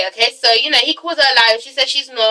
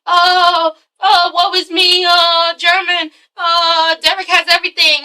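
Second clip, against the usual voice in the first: slow, higher mocking moan; woe-is-me whining; 4 semitones higher, slower than usual. Viewer mocking German for playing the victim, sing-song whining. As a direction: draw it out in fake self-pity. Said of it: "putting on the woe is me bullshit".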